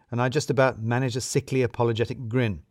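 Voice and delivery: ordinary delivery, nothing marked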